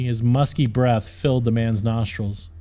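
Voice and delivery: ordinary delivery, nothing marked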